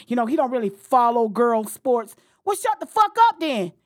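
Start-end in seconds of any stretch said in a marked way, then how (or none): 2.4-3.7 furious outburst. Real, not acted. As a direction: blast him, fast and furious. Raging at Olbermann.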